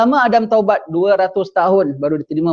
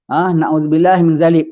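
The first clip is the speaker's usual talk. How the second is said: usual speaking voice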